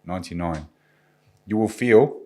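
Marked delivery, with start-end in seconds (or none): 0.0-0.7 ninety-nine with a calm falling tune; downward inflection. Shown as a demonstration. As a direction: let ninety-nine drop down into your chest. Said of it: "say the word ninety-nine with a downward inflection".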